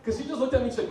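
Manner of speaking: ordinary talk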